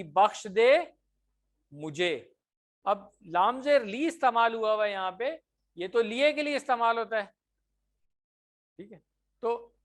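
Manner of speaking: ordinary delivery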